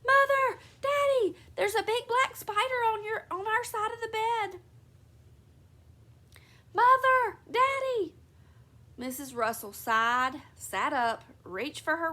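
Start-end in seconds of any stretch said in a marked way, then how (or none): 0.1-4.6 high, loud panicked scream; girl runs screaming to her parents, terrified; screaming child. Acted. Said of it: "went screaming into her mother's". 6.7-8.1 high, loud panicked scream; girl runs screaming to her parents, terrified; screaming child. Acted. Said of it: "went screaming".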